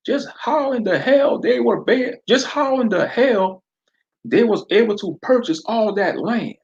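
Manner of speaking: southern sheriff daring Fred to use his eye blaster; southern accent. Acted. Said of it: "the sheriff responded".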